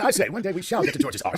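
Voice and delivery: hammed-up British gentleman, half laughing; British accent. Comic pledging to reach the planet, British accent. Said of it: "You say with a British accent".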